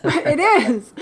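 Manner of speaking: amused laugh-talk. Real, not acted. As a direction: chuckle the agreement. Laughing agreement with co-host.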